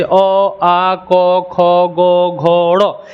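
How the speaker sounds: long, level अ, आ, क, ख, ग, घ, ड़ from the throat; sustained chant-like drill of अ, आ, क, ख; say-with-me sound drill. Shown as a demonstration. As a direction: lead the class through अ, आ, क, ख, holding each. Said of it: "आप बोलो मेरे साथ".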